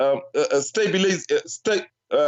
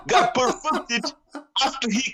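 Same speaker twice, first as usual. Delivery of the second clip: laughing through the words, higher; 5 semitones higher than usual. Real, not acted. Guest laughs as he corrects the host. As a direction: chuckle while you explain.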